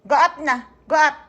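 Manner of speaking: loud, insistent short order; real parental scolding. Real, not acted. Mother repeats order to go upstairs.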